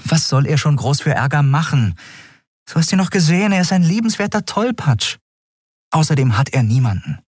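mildly pleading captain; defensive captain. A narrator's reading.

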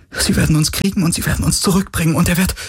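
high, fast rising panic; words tumbling out in terror; panicked. A narrator's reading. Panic as ship is hit.

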